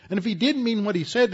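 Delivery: the echo thrown out with rising heat; emphatic repeat. Acted. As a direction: fire the repeat back harder. Repeated with force.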